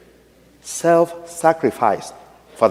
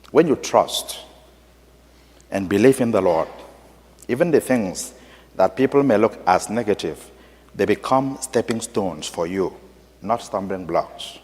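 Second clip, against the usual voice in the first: hushed retelling; 4 semitones lower, 7 dB quieter than usual. Retold or imitated. Retold wise words, softly.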